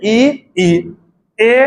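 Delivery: drawn-out ई then ए, sounded front of the mouth; vowel demo ee and e. Shown as a demonstration. Teacher to students, sounding out ई and ए clearly. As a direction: say ई, ई, ए slowly and clearly. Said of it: "बोल के देख".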